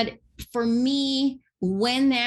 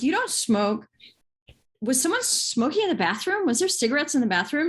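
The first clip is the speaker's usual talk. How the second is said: puzzled questioning; 5 semitones higher, faster than usual. Retold or imitated. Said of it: "I'm like".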